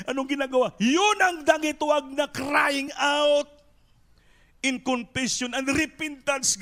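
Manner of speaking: full-voiced pulpit exhortation; fervent preaching. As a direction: preach it with growing fire.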